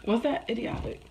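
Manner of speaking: hoarse near whisper; lost voice. Real, not acted. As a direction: voice nearly gone. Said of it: "I really lost my voice".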